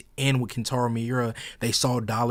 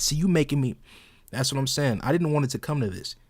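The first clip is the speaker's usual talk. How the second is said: ordinary talk, nothing marked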